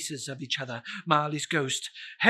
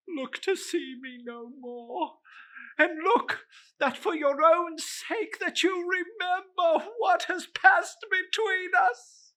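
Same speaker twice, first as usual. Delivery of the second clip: ghostly warning; 12 semitones higher than usual. Acted.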